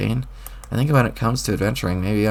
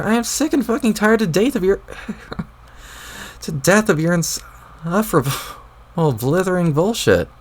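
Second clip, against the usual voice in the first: much higher, furious tirade; worked-up rant; 5 semitones higher than usual. Acted. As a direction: unload on him, voice up high. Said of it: "you seem really worked up".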